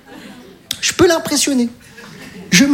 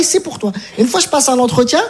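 ordinary talk, nothing marked

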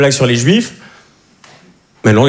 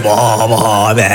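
annoyed ugh; 6 semitones lower, much slower than usual. Retold or imitated. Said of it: "Vous parlez comme ça".

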